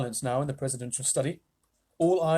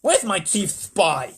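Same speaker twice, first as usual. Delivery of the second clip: loud high agitated president; excited president; 7 semitones higher, 7 dB louder, slower than usual. Acted. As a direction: demand answers urgently. President demanding answers.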